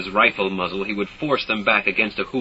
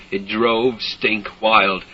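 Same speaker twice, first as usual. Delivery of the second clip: ordinary delivery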